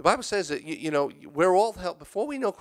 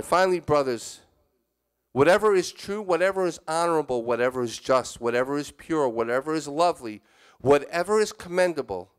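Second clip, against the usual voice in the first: scripture reading; 3 semitones higher than usual. Acted. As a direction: read the verse to the flock, each "whatever" set apart. Reads Philippians aloud to listeners.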